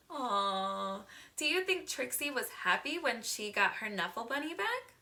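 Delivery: normal talking voice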